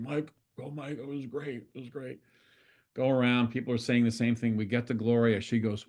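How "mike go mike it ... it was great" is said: guys' praise. Retold or imitated. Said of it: "Even the guys are saying".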